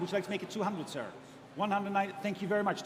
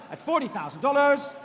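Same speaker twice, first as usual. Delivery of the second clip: final call, raised voice; loud; 7 dB louder than usual. Real, not acted. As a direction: belt out the last call. Closing the sale, final call.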